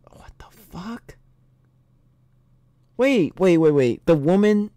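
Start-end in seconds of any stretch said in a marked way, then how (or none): 0.1-1.2 very soft, whispered disbelief; stunned whisper. Real, not acted. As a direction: whisper it in shock. Stunned aside at tarantula eating.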